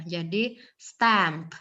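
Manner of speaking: stamp very slowly with a clear P; stamp, drawn out, P at the end. Shown as a demonstration. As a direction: stamp spoken slowly for learners. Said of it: "ada p di belakangnya".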